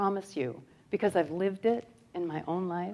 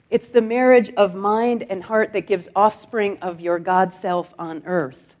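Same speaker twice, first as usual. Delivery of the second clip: usual speaking voice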